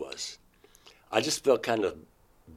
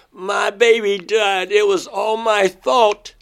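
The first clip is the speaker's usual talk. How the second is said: anguished crying; 12 semitones higher, 10 dB louder than usual. Retold or imitated. Lying on the floor sobbing to Crescent about the baby. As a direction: cry it out as if the baby is gone. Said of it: "And I remember saying".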